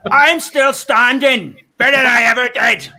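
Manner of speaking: loud, high, menacing Northern Irish bark; Northern Irish accent. Shown as a demonstration. [name] lyric as terror phone warning, Northern Irish shout. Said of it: "[name] lyric in a Northern Irish accent".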